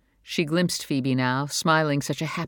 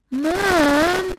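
high, loud exasperated cry; 5 dB louder than usual. A narrator's reading. Phoebe exasperated at her mother, a high loud cry. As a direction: whine the word in frustration. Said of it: "Phoebe was exasperated".

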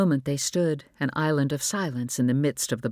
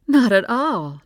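gushing mother; 9 semitones higher, 7 dB louder, slower than usual. Acted. Mother gushes over son.

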